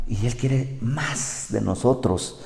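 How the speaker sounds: ordinary talk, nothing marked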